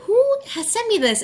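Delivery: high, astonished question; asking yourself in disbelief; surprised inner thought. Retold or imitated.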